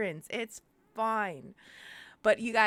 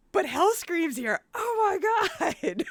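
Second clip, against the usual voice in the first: overwhelmed; 7 semitones higher, 6 dB louder than usual. Real, not acted. Streamer overcome that Hellscream joined, emotional cry. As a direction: let it break into a happy whine. Still overcome by idol's presence.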